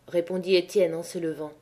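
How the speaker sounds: plain reading voice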